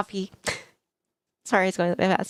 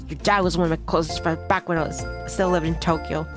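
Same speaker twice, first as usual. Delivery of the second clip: a slightly lowered fatherly voice; lightly voiced welcoming dad; 4 semitones lower than usual. Host dad welcoming his friend's child as family, lightly reassuring.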